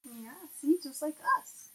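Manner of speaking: ordinary delivery, nothing marked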